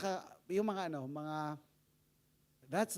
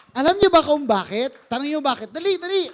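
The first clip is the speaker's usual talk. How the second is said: loud, high call to answer; rousing the congregation; 6 semitones higher, 16 dB louder than usual. Real, not acted. Preacher urging the congregation to answer, loud and high. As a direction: urge them to answer fast.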